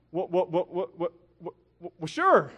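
stuttering, caught off guard; comically tongue-tied; flustered stammer. Retold or imitated. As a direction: stammer in starstruck fluster before blurting it out. Acts out his flustered reply.

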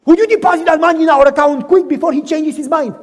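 excited prodding; eager urging. Acted. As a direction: hurry before he changes his mind. Wife urges hurry to bank.

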